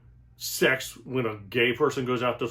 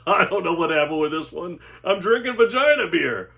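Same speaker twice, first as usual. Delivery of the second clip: laughing; 6 semitones higher, 5 dB louder than usual. Real, not acted. Laughing wrap-up after bit.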